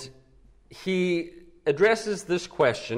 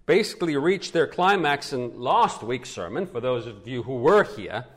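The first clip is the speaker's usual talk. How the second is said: ordinary delivery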